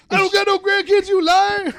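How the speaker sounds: high, loud cartoon shout; hammed-up angry grandpa. Grandpa denying his grandkids, high loud mock fury. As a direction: yell it as a cartoonish old man.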